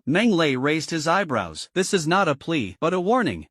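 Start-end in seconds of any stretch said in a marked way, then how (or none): none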